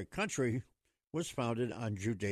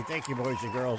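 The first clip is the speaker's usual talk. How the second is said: normal talking voice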